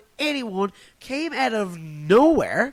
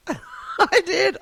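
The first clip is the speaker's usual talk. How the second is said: laughing; 10 semitones higher than usual. Real, not acted. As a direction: laugh through the words. Laughing about own joke.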